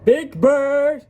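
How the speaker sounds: high, loud call. Acted. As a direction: holler the name to summon him. Calling Big Bird over.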